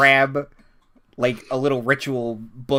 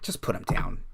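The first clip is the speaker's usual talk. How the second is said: hushed, unvoiced order; quiet; 4 semitones lower, 5 dB quieter than usual. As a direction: breathe it out low. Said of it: "Storm Banner is just like".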